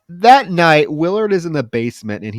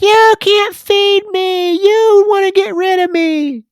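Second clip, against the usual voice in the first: put-on much higher, louder, slow hysterical old woman; shrill mock old mother; 7 dB louder, slower than usual. Mother accusing her son, much higher hysterical screech. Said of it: "She's like".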